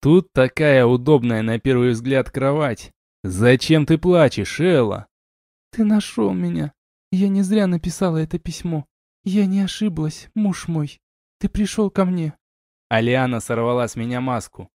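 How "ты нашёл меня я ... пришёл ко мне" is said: tearful wife. A narrator's reading. Wife to the husband who found her, tearful relief. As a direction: speak as a woman overcome with relief.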